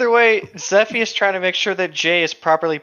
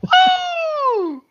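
excited whoop. Real, not acted.

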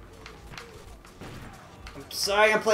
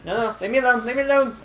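high frantic pleading; panicked pleading; 7 semitones higher, 4 dB louder, faster than usual. Real, not acted. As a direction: beg to be left alone. Fleeing an enemy in game.

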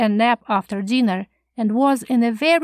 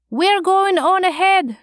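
higher-pitched cheerful call; 8 semitones higher, 3 dB louder than usual. A narrator's reading. Woman calling to those she passes, calling out. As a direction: call it out to people behind. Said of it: "she called".

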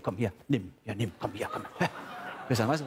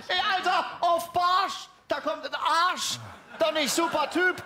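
high indignant rant; 13 semitones higher, slower than usual. Acted. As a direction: rant up high like a furious critic. Ranting about the rhyme.

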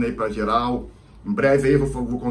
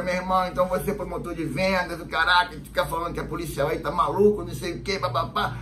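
teasing cop; 5 semitones higher than usual. Retold or imitated. Cop teasing the fake policeman.

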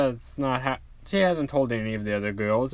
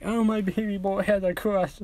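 much higher giddy mother gushing; happy and giddy; 6 semitones higher than usual. Acted. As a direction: coo over her boy like a doting mom. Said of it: "all happy and giddy".

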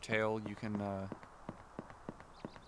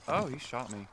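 ordinary delivery

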